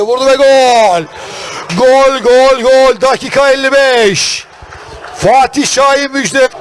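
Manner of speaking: shouting gol, high and loud; excited goal call. Real, not acted. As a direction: scream the goal, drawn out. Goal call in match commentary.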